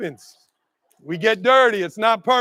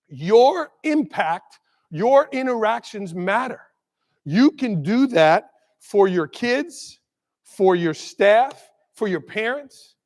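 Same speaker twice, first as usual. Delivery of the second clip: slow emphasis; slower than usual. Real, not acted. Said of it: "slow emphatic takeaway".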